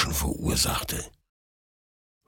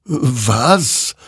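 wondering; 6 semitones higher, 4 dB louder than usual. Acted. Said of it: "wunderte sich dieser".

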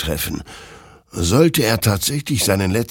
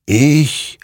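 one loud, raised, drawn-out word; ghostly rider's answer; 8 semitones higher, 6 dB louder than usual. A narrator's reading. Rider claims victory to Hector, imposing. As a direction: stretch the single word with dark authority. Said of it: "murmelte dieser".

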